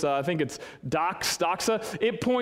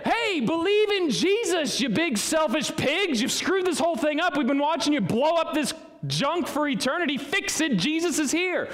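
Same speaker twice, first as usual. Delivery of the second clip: mock scolding angel; 10 semitones higher than usual. Angels berating selfish humans, hammed-up angry rant. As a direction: scold them like an exasperated angel.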